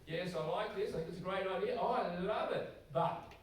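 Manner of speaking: mock gushing fan. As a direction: lightly parody an over-eager yes-sayer. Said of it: "those people who go".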